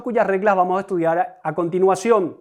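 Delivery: ordinary talk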